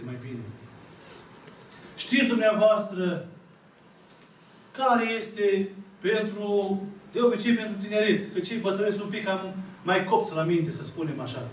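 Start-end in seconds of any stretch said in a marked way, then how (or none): none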